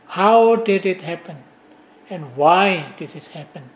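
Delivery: usual speaking voice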